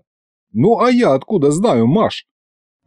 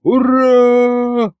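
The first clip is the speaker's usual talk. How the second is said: high, drawn-out hurrah; long high-pitched cheering cry; crowd's cheer; 9 semitones higher than usual. A narrator's reading. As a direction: cheer like a crowd finally waking up. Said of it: "очухалась наконец-то толпа".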